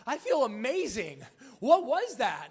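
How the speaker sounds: high, loud giddy rush; elated Sarah. Retold or imitated. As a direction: gush about feeling amazing.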